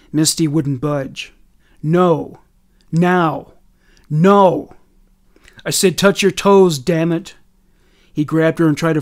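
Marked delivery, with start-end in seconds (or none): 1.8-7.3 escalating raised-voice exchange; heated standoff. A narrator's reading. Brian demanding and Misty refusing, increasingly angry. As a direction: trade forceful one-word commands and refusals, building.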